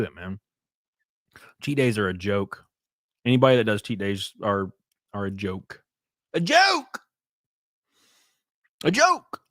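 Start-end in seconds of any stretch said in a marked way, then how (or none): 6.3-9.4 squeaky high mock cry; mocking high yelp. Mocking repeated shout.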